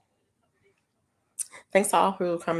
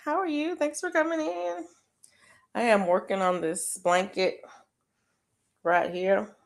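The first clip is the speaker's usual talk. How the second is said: normal talking voice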